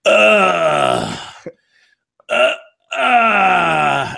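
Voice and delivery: long, high moaning groans; loud drawn-out groan, sliding slowly down; imitated neighbor's groans. Man mimicking his downstairs neighbor's morning noises, long loud groans. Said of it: "he goes like this every morning".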